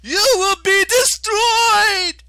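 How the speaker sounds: shrill drawn-out screaming threat; shrieked. Acted. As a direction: scream the threat at full force. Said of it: "Octavian shrieked".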